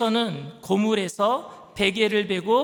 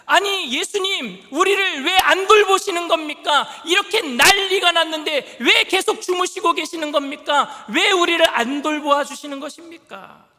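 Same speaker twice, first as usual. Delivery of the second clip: higher, louder, exasperated pleading; irritated complaint; 5 semitones higher, 7 dB louder than usual. Acted. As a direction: demand answers from a sleeping master, fed up. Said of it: "조금 화가 나고 신경질적인 반응인".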